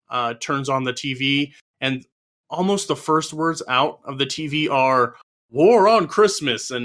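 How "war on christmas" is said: slow, weighty announcer read; dramatic TV announcer. Retold or imitated. Mother turns on the TV, announcer intones. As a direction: boom it out like a news headline.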